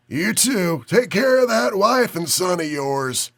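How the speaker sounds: put-on high, coughing old man with Chicago accent; sick old man, Chicago accent. A narrator's reading. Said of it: "a cough talking with a heavy Chicago accent".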